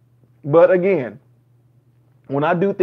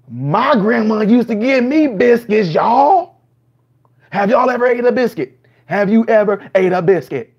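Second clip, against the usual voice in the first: higher, louder hammed-up gushing with laughter; over-the-top reaction parody; mock ecstatic reactor; 6 semitones higher, 5 dB louder than usual. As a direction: gush like the lyric moved you to tears. Mock ecstatic reaction about biscuits.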